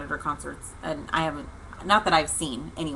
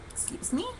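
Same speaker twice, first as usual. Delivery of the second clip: breathless, higher, hushed disbelief; stunned whisper; 9 semitones higher, 9 dB quieter than usual. Real, not acted. Hearing the tow truck came for her, stunned whisper. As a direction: gasp it out in disbelief.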